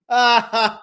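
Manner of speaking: a quick high laugh of triumph; short gleeful laugh. Real, not acted. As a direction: chuckle while gloating. Laugh between gloating lines.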